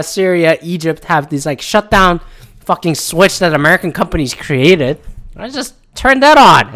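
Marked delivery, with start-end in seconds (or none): 5.4-6.7 mock smug country. Mock countries flipping a switch.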